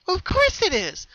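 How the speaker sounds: very high, slow, beaming reassurance; happily. Acted. Pinky to her younger sister, happy. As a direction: sing it out with delight. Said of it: "Pinky said happily".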